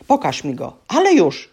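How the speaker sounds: loud, snarling order rising at the end; growled command. A narrator's reading. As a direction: snap the command. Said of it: "warknął Wyrwigrószka".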